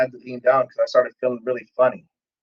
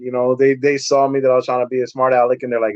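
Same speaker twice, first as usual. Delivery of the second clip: usual speaking voice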